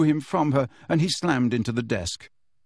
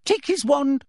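much higher, sharp command; barked order; 13 semitones higher, 3 dB louder than usual. Acted. Umbridge barking at a student, sharp. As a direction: bark the order. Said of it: "she barked".

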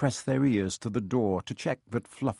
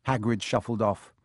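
ordinary delivery, nothing marked

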